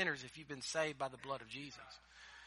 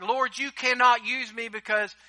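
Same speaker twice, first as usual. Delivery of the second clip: pleading excuse; 6 semitones higher, 8 dB louder than usual. Retold or imitated. Voicing excuses to the Lord.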